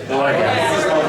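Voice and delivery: struggling to speak through real laughter; loud word, then cracking up; barely getting it out. Real, not acted. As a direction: choke it out while laughing. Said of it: "Can't barely get it out".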